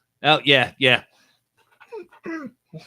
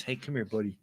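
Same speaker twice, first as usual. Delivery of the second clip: ordinary talk, nothing marked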